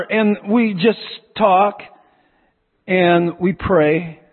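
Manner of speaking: slow and gentle; preacher softening as the father prays with his child; tender. Acted. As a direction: let it slow right down, hushed. Tender slow moment in story.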